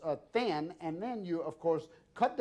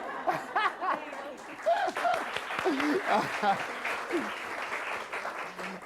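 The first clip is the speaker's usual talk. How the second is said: a big delighted laugh; hearty laugh; 9 semitones higher than usual. Real, not acted. Host laughing at his own childhood photo.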